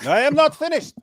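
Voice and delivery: high, loud mock scolding; mock stern shout. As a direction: bark it like a bossy debater. Mocking the interviewer in a quarrel.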